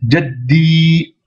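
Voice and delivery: "jaddi" with doubled d and long held i; prolonged ending. Shown as a demonstration. Teacher adding the prolongation, "jaddi" with drawn-out i. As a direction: block the d, then stretch the final i evenly. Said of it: "et une prolongation".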